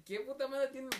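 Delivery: loud, high mock rant; exasperated outburst. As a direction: vent at WhatsApp. (él quejándose de WhatsApp, fuerte y agudo)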